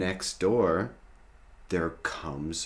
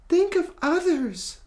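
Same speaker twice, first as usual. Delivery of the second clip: very high, loud pleading voice; begging Molly; 7 dB louder than usual. Acted. Molly begs Rory in a very high voice. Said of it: "Molly begs".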